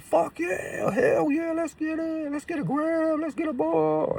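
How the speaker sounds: mock the hyped rush, way up high; hyped-up shout. Imitating his high self, yelling high and excited.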